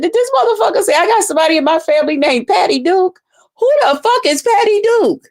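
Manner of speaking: high, fast disbelieving retort; incredulous outburst. Retold or imitated. Retelling her reply to the rival, high and incredulous. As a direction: scoff at the made-up name. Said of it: "I said".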